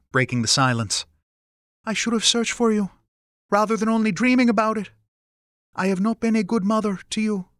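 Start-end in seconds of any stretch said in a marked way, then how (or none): 1.9-7.5 high-pitched mother confessing regret; estranged mother, regretful. Acted. Mother admitting failure to her son, regretful. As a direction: apologise in a higher mother's voice.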